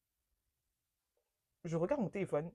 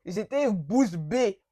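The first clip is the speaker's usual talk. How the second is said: BOUCHE BÉE stretched out slowly and louder; emphatic disbelief; 5 dB louder, much slower than usual. Retold or imitated. Telling how stunned she was, "bouche bée" slow and emphatic. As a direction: drag out "bouche bée", weighting each word. Emphatic repeat of being dumbstruck.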